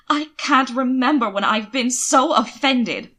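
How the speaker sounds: slow, loud, indignant; every word weighted with offence; offended. A narrator's reading. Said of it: "fire rising in his eyes".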